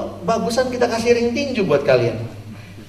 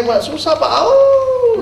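high, wailing mock whine; aunt wailing to the pastor with a laughing whine; whining aunt; 4 dB louder, much slower than usual. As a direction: whimper like a wronged aunt begging.

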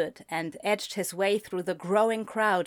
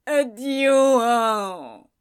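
moaning; 4 semitones higher, 8 dB louder than usual. Acted. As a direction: wail it into the table. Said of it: "he moaned".

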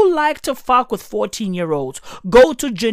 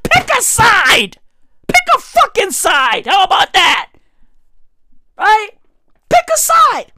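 high, loud exasperated yelling; shouted rant; 11 semitones higher, 3 dB louder than usual. Real, not acted. Comedian yelling at Switzerland to pick a side. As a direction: yell it at them, fed up.

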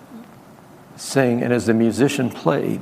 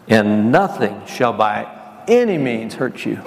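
slow proclamation; slower than usual. Acted. Preacher proclaiming scripture slowly.

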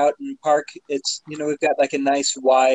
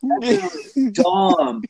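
higher, drawn-out incredulous complaint; retold disbelief; 6 semitones higher, slower than usual. Telling Tom about the second crash, incredulous and higher. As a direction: whine it like you can't believe it happened again. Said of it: "And I was just like".

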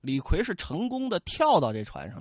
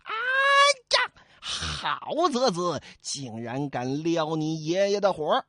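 furious Li Kui; slower than usual. Acted. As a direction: explode with rage. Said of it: "李逵一听大怒啊". (李逵暴怒大吼)

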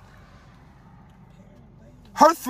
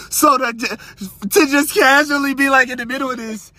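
ordinary talk, nothing marked

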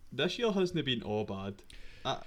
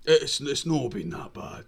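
put-on old man voice; 2 semitones higher, 5 dB louder, slower than usual. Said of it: "old man voice".

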